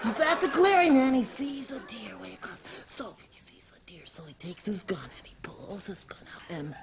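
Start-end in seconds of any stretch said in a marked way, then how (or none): 1.2-6.7 hushed build-up to the shot; stage whisper. Acted. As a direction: whisper stealthily, as if stalking a deer. Hushed telling before the shot.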